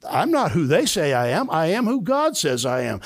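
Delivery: normal talking voice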